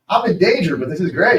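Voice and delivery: high, loud mock-excited cry; gleeful alarm. Imitating kids flipping off stage, gleeful alarm. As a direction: call it out like a thrilled kid in peril.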